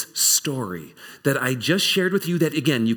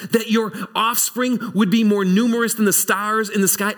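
lightly raised, exasperated God pleading with Abraham; God gently scolding a doubting friend, pitched up; a hint of an incredulous God, higher; God's voice; 7 semitones higher than usual. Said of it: "God shows up and goes".

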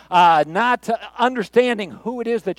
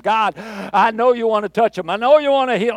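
rising, louder mock revival prayer; fancy prayer; 3 semitones higher, 6 dB louder than usual. Faith healer praying as crowd gets worked up, mock fervent prayer. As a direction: build the showy prayer toward a pitch. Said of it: "one of them fancy prayers".

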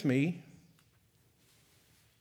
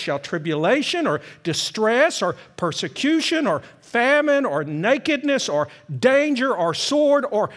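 louder, proclaimed scripture; fervent scripture reading; 6 semitones higher, 5 dB louder than usual. Acted. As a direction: proclaim the verse to the congregation. Reads Romans with fervor.

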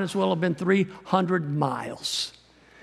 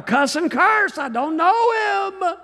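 much higher, slow, strained cry; cursing denial; 12 semitones higher, 4 dB louder, slower than usual. Acted. Peter cursing and denying Jesus at the fire, high and strained. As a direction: bellow the denial in panic.

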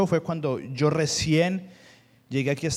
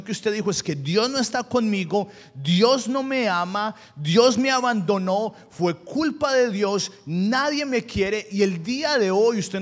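higher, louder, slowing on the hard lines; fervent preaching; 6 semitones higher, 5 dB louder than usual. Acted. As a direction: preach it out, pressing each painful thought. Pastor presses the congregation.

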